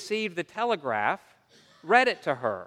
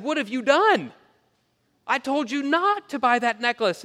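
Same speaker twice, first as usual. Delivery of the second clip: exasperated husband; 8 semitones higher than usual. Acted. Husband home from work confronting his wife, exasperated. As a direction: scold her in a raised, high-pitched voice.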